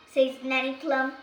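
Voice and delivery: usual speaking voice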